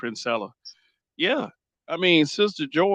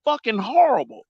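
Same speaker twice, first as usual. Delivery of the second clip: retold disgust; 9 semitones higher than usual. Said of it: "I said".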